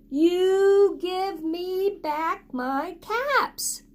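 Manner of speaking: slow, high, drawn-out demand; scolding peddler. Acted. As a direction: stretch every word like a cross peddler. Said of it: "he said, shaking a finger".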